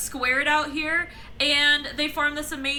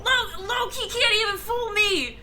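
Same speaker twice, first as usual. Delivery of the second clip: wrestler promo voice; 6 semitones higher, 5 dB louder than usual. Pretend. Continuing wrestler promo bit.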